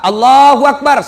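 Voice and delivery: slow, loud drawn-out takbir; takbir call. Shown as a demonstration. Preacher demonstrating the first takbir, slow and loud. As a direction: call Allahu Akbar slowly like an imam. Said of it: "Takbir kedua".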